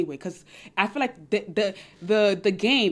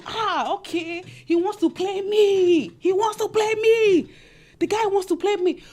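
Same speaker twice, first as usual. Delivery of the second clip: much higher, slow knowing repetition; scheming realization; 8 semitones higher, slower than usual. Retold or imitated. Woman realizing her man plays her, high and slow. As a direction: savour the discovery. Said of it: "you say".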